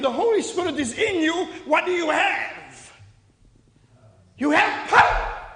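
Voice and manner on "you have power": loud, forceful burst on the last word; emphatic declaration. Shown as a demonstration. Preacher showing the congregation how to answer, emphatic and loud. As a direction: declare it like you mean it. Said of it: "say it like you mean it".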